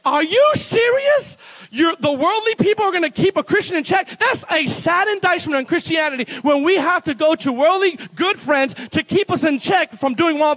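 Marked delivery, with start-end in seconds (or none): 0.0-4.2 incredulous. Retold or imitated. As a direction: stretch it out in shock. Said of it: "I kept saying to this person".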